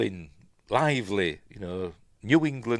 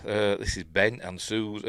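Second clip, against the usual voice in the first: usual speaking voice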